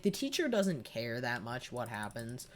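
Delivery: ordinary talk, nothing marked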